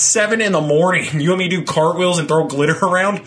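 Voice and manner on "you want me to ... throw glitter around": ordinary talk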